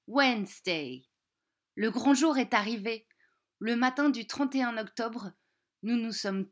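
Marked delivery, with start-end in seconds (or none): 0.1-1.1 Wednesday said the English way; English pronunciation. Shown as a demonstration. Teacher models Wednesday for French kids, in English. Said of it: "on le prononce".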